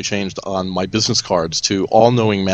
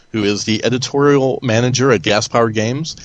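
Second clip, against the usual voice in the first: usual speaking voice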